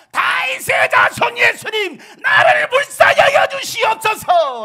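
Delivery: slow, very high full-force shout. Acted. Bartimaeus begging Jesus for mercy, loud pleading shout. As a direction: cry out desperately at the top of your voice. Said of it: "더 큰 소리로 외칩니다".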